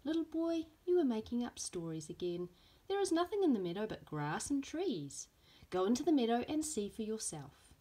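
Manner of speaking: put-on a mum gently telling off a fibbing child; scolding mother. Mother scolds son in story.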